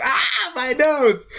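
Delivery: high, slow hammed-up cry; mock pained wail. Thug cries out after Belmont's boot. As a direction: wail over a smashed nose. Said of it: "He's like".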